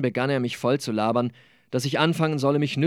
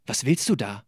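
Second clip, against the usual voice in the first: plain reading voice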